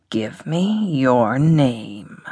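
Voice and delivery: low, very slow menacing command; commanding warning. A narrator's reading. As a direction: demand it as a warning, drawn out. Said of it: "he commanded, his voice thick with warning".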